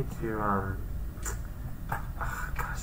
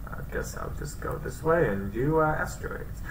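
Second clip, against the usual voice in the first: normal talking voice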